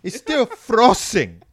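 high, loud mock yell, slow, breaking into laughs; mom yelling. Mom finding frozen chicken, loud mock outburst. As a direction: imitate an exasperated mom yelling. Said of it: "She's just like".

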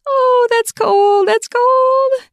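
high, loud and slow squealing; cold shock squeal. Acted. Reacts to cold can.

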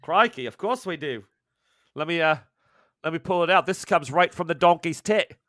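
cheeky Aussie pour, played up; Aussie barmaid. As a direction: Clarice offering the strong drink in Aussie accent. Barmaid Clarice serves drink.

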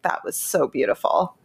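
usual speaking voice

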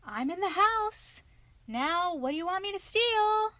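high little-lunged yell. A narrator's reading. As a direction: shout it high for all to hear. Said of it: "at the top of his little lungs".

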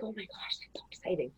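breathless whisper of delight; whispered excitement. Retold or imitated. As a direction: whisper it like a secret thrill. Voicing an excited inner thought.

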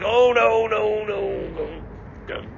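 high, strained no no no; high panicked protest. Acted. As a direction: squeak out the panic. Panicked drunk skit.

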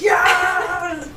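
very high, excited creature shout; William cheering. Acted. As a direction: squeal it out in celebration. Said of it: "William's like".

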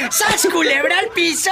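laughing. Real, not acted. Caller's story punchline.

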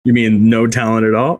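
ordinary talk

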